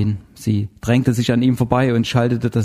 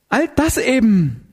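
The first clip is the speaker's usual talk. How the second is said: high, drawn-out desperate answer; 11 semitones higher, slower than usual. A narrator's reading. Zinzendorfer answering Heller's questioning, desperate. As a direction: stretch it high, at wits' end. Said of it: "sie machte eine verzweifelte Geste".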